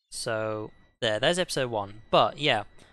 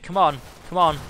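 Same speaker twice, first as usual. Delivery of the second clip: loud, urgent come on; urgent shout; 4 semitones higher, 7 dB louder than usual. Real, not acted. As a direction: push them, loud and urgent. Urgent in-game moment.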